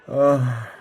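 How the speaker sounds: loud, frustrated groan of "ugh"; exasperated cry. A narrator's reading. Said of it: "cried Femi".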